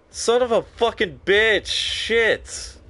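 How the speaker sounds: high, slow cursing at the game; drawn-out high angry swearing. Real, not acted. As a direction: swear out your fury.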